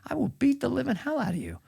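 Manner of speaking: a hint of Biden, blustering; Biden impression. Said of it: "Why are you whispering?".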